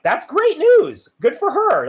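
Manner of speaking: high, loud, drawn-out delight. Retold or imitated. Reacting to cousins' news of grandma's death, delighted. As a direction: celebrate it as wonderful news.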